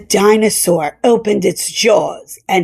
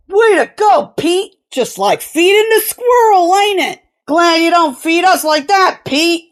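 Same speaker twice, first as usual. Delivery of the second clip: high, jeering banter; heckling crewman; 7 semitones higher than usual. A narrator's reading. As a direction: needle him for a laugh.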